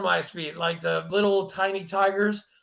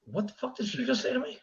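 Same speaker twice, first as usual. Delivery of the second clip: incredulous inner voice; 5 dB quieter, faster than usual. Retold or imitated. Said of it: "I was like".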